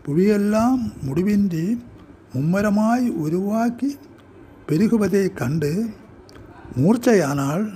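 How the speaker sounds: measured poetry reading, pausing after each short phrase; deliberate, declaimed verse with separated phrases; poem recitation. Acted. Poet reads own poem aloud.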